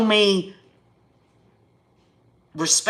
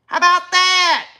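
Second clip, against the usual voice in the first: punchy and raised, daring them to answer; defiant challenge; 8 semitones higher than usual. Real, not acted. Defiant challenge to chat critics.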